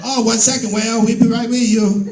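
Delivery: imitating masseuse twin. Masseuse twin greeting him.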